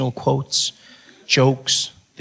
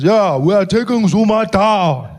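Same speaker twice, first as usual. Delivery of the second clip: slow, louder, drawn-out voice; Malema impression; 5 semitones higher, 4 dB louder, slower than usual. Malema chatting with the comic, slow louder voice. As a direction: lean back and drawl it. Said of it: "He says".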